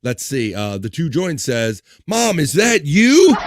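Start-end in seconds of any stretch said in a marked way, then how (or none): 2.0-3.4 loud high shocked cry. Pretend. Reads a comment as a shocked son.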